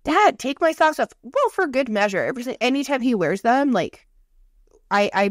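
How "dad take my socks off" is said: put-on high, louder whiny kid voice; child scolding her dad, whiny; annoyed daughter. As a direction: play the fed-up daughter. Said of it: "She's like".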